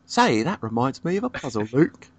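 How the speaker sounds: put-on copying the British accent; mock British accent, echoing the line. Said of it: "we're breaking out that accent".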